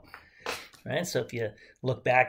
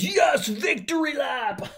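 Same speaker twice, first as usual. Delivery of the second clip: loud, high, drawn-out victory cheer; triumphant shout; 12 semitones higher, 5 dB louder, slower than usual. Pretend. Teacher celebrating a straight line with the class, triumphant shout. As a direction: shout it out like a winner.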